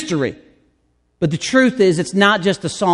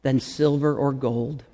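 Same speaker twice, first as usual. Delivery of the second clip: hymn recitation. Acted. Pastor reciting an old hymn to open the sermon, reverently. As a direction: let the hymn words settle gently.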